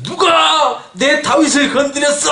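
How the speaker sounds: high, drawn-out outraged cry; angry God. Acted. Preacher acting God rising up for David, angry. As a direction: roar it out as an enraged, protective God. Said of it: "화난 하나님이 나타나시는 거예요".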